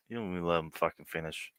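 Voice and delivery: ordinary delivery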